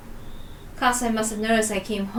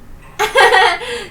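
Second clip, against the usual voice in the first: high loud cackle of amusement; loud high laugh; 11 semitones higher, 9 dB louder than usual. Acted. Sister cracking up over the school, loud high laugh.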